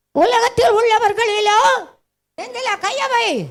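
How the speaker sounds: high, shouted revival preaching; full-force preaching. Real, not acted. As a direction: cry it out with rising fervour to the crowd.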